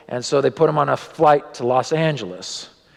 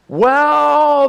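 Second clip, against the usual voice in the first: ironic exclamation; 14 semitones higher, 7 dB louder than usual. Acted. Ironic turn in the story.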